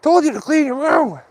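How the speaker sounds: mock shout of a dad at his kid; mock yelling dad. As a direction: bark it like a cranky father at his kid. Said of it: "he's gone to yell at him".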